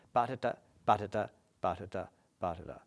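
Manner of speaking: quick "Ba-da-da", first syllable stressed; dactyl rhythm. Shown as a demonstration. Teacher showing it's perfect, brisk dactylic beat. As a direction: tap out "Ba-da-da" as strong-weak-weak, quickly.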